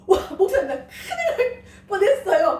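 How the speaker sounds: laughing out a near-miss story, high; half-crying laugh of relief; laughing, retold shock. Disciple telling the pastor, laughing in mock alarm. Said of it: "깜짝 놀랐대요".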